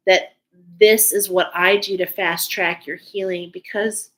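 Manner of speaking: usual speaking voice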